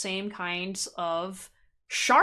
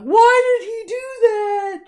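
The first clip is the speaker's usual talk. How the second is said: mock wail; 12 semitones higher, 10 dB louder, slower than usual. Mock heartbroken cry into pillow.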